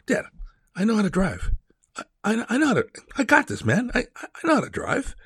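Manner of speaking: put-on high, breezy cocky teenager voice; cocky teen son. Son brushing off his dad's advice, in a high cocky teen voice. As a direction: play the know-it-all kid brushing off his dad.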